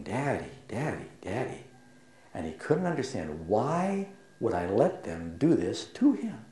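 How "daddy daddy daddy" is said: put-on soft, tearful child's calls of daddy, dying away; hushed, pleading daddy from a hurting toddler; imitating a crying child. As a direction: echo a frightened boy calling his dad through tears. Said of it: "and he's crying".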